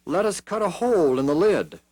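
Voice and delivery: put-on a young king proposing a plan; boy king Joash. A narrator's reading. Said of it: "said Joash".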